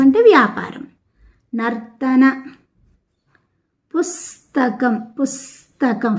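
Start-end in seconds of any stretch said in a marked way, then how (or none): 3.9-6.1 పుస్తకం slowly, with a long held స్ before త; స first, then త. Shown as a demonstration. Teacher shows pupils the conjunct, పుస్తకం with స్ before త. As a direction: stretch the స్ in పుస్తకం, then say తకం. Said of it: "first సా పలికి తర్వాత తా".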